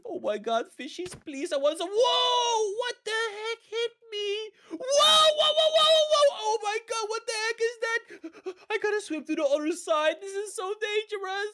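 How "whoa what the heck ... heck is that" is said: high, loud frightened yelps; panicked screaming. Acted. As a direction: shriek in panic. Hit by giant monster fish.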